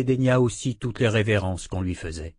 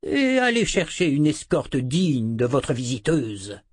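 haughty command; 4 semitones higher than usual. A narrator's reading. Zed continues his order.

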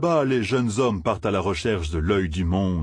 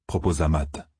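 plain reading voice

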